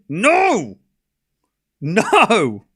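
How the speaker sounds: high, drawn-out no; protesting cry. Real, not acted. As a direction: wail it out in loud refusal. Protesting a rival bandwagon.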